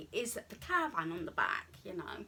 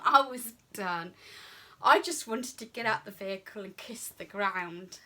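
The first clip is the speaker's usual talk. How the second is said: loud, relieved exclamation; relieved outburst; 2 semitones higher, 10 dB louder than usual. Retold or imitated. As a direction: let it burst out like you survived. Relief after parking the caravan.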